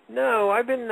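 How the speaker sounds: ordinary talk, nothing marked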